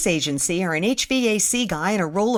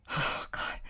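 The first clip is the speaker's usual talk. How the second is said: whispered, nearly fainting; a faint gasp of dismay, no voice; breathless whisper; 13 dB quieter than usual. Retold or imitated. Narrator in stifling office heat, breathless whisper.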